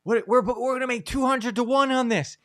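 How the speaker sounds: high, confused disbelief; baffled protest. Retold or imitated. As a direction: protest that they won. Confused interns answer Brad Pitt.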